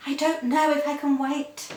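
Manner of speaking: can't hold back her desire; a mock-passionate plea; eager. Martha's eager reply.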